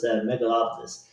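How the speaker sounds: ordinary delivery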